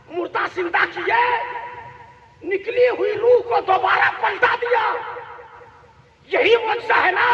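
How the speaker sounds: fervent sermon, ringing out phrase by phrase; impassioned preaching. Real, not acted. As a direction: proclaim the miracle to the crowd in short bursts. Preaches at rising, full force. (जोशीली तक़रीर, टुकड़ों में पुकारते हुए)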